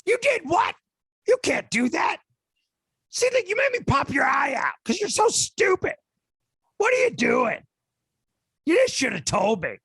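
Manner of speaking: very high, loud, shouting rant; angry Joe Pesci. Acted. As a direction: explode at him like a furious gangster. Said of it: "I guess I gotta get angry".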